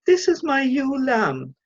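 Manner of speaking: high, slow, affected introduction; posh mum voice. A narrator's reading. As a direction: show off your child in a high posh voice. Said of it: "higher than usual and sort of posh".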